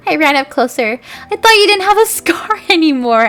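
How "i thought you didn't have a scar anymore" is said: high loud shout with a laugh. A narrator's reading. Girl shouting at Vampire about his scar, high laughing shout. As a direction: call it out, laughing. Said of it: "I shouted".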